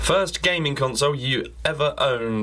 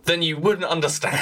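laughing; 5 semitones higher than usual. Real, not acted. Mock-serious joke, laughs.

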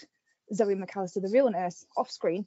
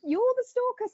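much higher, stunned recognition; shocked disbelief; 11 semitones higher, 3 dB louder than usual. Retold or imitated. Sally recognising her kidnapper, high shocked disbelief. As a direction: gasp it out, high and incredulous. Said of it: "Sally's face was just like".

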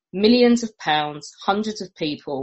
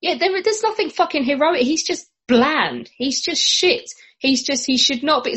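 high, exasperated rant; exasperated outburst; 9 semitones higher than usual. Real, not acted.

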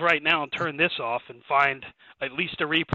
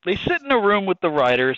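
ordinary talk

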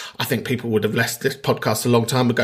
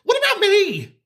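much higher, whiny mock self-pity; high camp voice; 13 semitones higher than usual. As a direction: whine it way up high.

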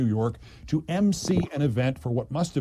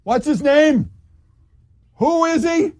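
loud, much higher, baffled asking; loud confused question; 13 semitones higher, 7 dB louder than usual. Retold or imitated. Mayor asking the deputy mayor aloud, loud and confused. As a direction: call out the question, lost. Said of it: "said loudly like this".

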